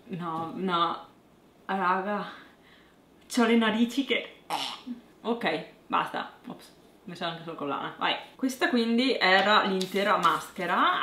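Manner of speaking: lips coated in mask cream; careful stiff lip movement; with face cream mask on. Real, not acted.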